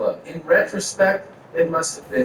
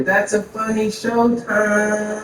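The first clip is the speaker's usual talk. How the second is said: high, drawn-out celebrity impression; Mike Tyson; 6 semitones higher, much slower than usual. Boxer surprising the comic backstage after his show, high drawn-out impression. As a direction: stretch it out high, holding the last word. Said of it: "as I feel that grab, I hear".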